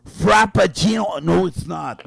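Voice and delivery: ordinary talk, nothing marked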